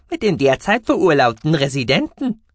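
high squeaky mouse voice, uneasy; uneasy little alien squeak; squeaky creature voice. A narrator's reading. Gucki the mouse-beaver speaks.

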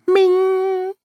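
high, long-held ping; ringing vase. A narrator's reading. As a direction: imitate a struck vase ringing out. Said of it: "klopfte gegen den Topf. Es machte".

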